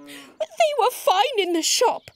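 high, slow little-dinosaur whine; upset Dewi. A narrator's reading. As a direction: protest high and drawn out. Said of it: "replied Dewi".

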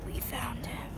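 breathy mock-spy whisper. Vlogger sneaking round Target, whispering to camera. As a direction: hush it like a spy hiding out.

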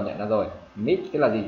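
normal talking voice

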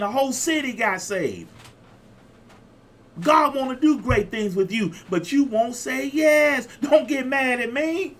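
high, driving sermon delivery; preaching. Real, not acted. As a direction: preach up high, pressing the congregation. Preaches at full force.

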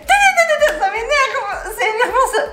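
high, slow laughing exasperation; exasperated. Real, not acted. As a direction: groan it out in mock despair. (exaspération aiguë et rieuse)

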